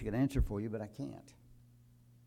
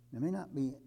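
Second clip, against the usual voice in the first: higher, louder warning from the pulpit; rising preaching; 8 semitones higher, 6 dB louder than usual. Acted. Preacher warns congregation about hobbies, raised preaching voice. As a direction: lift the voice to warn them.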